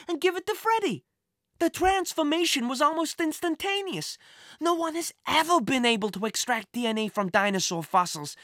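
put-on high-pitched boy bursting with pride; excited exclaiming. A narrator's reading. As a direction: gush in a high, put-on boy's voice. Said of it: "he exclaimed".

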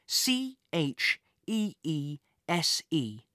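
slow, letter by letter; spelled out slowly. A narrator's reading. Punching code letter by letter.

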